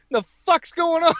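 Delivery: high-pitched baffled question; retold bewilderment. Veteran looking back, high baffled alarm. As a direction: squeak out the disbelief. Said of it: "he just kinda looked back like".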